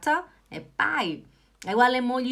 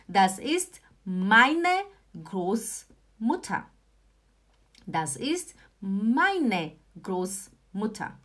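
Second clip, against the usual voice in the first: slow model sentence; 2 semitones lower, much slower than usual. Shown as a demonstration. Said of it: "teacher repeats slowly for students".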